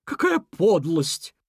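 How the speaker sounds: drawn-out scornful exclamation; contemptuous. A narrator's reading. Elk condemning the beaver's meanness, contemptuous. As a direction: spit it out slowly with disgust. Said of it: "презрительно фыркнул Лось".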